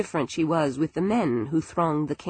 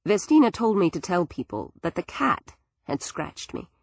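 usual speaking voice

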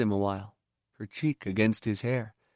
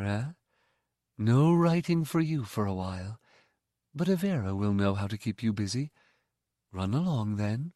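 softer, kindly and calm; gentle mother; 3 dB quieter than usual. Acted. The mother dismisses the boy, soft and kindly. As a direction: speak warmly to the boy.

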